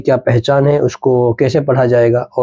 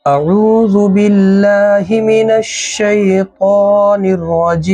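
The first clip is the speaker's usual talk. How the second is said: slow chanted ta'awwudh and basmala; Quranic recitation; 4 semitones higher, much slower than usual. Acted. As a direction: intone it like a reciter opening the lesson. Opens the lesson with recitation.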